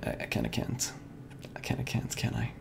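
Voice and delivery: soft whispered admission; whispering. Real, not acted. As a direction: whisper it sheepishly. Sheepish admission.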